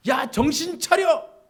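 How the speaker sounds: loud, high, slow bellow of warning; shepherd's shout. Acted. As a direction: yell at the flock to stay alert. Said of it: "하면서 막 소리를 치는 거예요".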